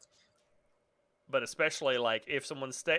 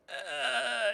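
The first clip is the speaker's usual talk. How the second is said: strained dying groan, crying; overacted death scene; 11 semitones higher than usual. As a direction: gasp it out like you're dying. Dying character crawling on floor.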